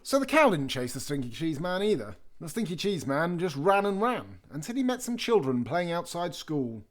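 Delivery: usual speaking voice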